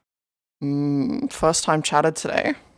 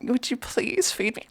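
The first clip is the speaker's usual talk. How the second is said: kitten voice; 4 semitones higher, 7 dB quieter than usual. Pretend. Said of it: "Is it better if I'm like".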